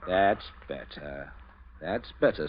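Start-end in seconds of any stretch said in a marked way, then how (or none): 0.1-1.3 drawn out, slow and pleased; slow and satisfied. Acted. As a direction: savour each word slowly. Captain pleased once obeyed.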